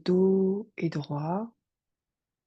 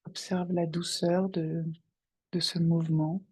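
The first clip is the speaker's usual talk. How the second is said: guided meditation. Acted. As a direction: guide them gently into their breath. Guide une méditation. (voix de méditation guidée, calme et posée)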